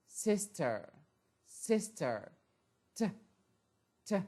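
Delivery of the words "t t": crisp aspirated T sound, "T". Shown as a demonstration. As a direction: say "T" with a sharp puff of air. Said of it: "Letter T".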